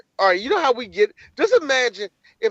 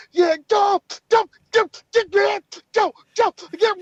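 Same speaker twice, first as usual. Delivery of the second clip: cheering partygoer; 13 semitones higher than usual. Retold or imitated. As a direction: egg him on, hammed-up and high. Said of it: "All you hear is".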